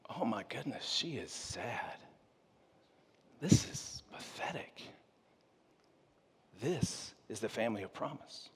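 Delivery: hushed, breathless whisper. Real, not acted. Preacher confiding about Leah's sad story, in a whisper. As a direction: whisper it as if sharing a pitiful secret.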